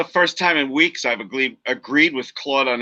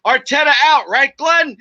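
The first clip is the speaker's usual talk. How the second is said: mock taunt; 10 semitones higher, 5 dB louder than usual. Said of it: "the first words out of Claude's mouth when I talked to him was".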